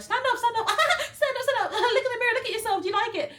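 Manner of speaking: very high, loud rapid commands; frantic urging. Retold or imitated. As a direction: imitate a flustered seller ordering you up, laughing through it. Imitating frantic seller giving orders.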